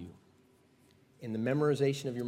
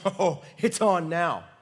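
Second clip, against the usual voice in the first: loud, raised mock thrill; excited prophet; 5 semitones higher, 7 dB louder, much slower than usual. Prophet feeling the ground shake, excited. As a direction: whoop it with a laugh in the voice.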